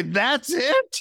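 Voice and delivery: high-pitched, rising disbelief; retold outraged disbelief, voice shooting up; incredulous. Traveller learning the long-sought mantra is two words, incredulous. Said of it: "And it hits me. I travel all the way".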